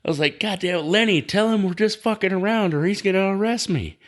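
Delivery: high-pitched, urgent pleading to a friend; mock panic. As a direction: plead high, as if about to be arrested. Retold panic at possible arrest.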